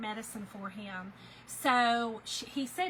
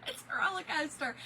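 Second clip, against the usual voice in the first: laughing through tears; 6 semitones higher than usual. Real, not acted. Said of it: "one minute I'm crying, one minute I'm laughing".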